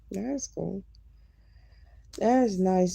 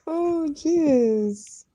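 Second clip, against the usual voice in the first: surprised; 7 semitones higher, 6 dB louder than usual. Real, not acted. Reacts to chat.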